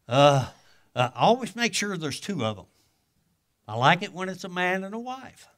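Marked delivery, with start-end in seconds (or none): none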